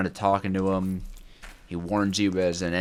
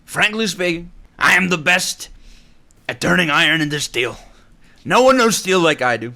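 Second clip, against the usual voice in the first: higher, louder swaggering boast; mock boastful bragging; 7 semitones higher, 7 dB louder than usual. Streamer hamming up a boast to chat about steel, higher and louder. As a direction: brag like a self-proclaimed steel champion.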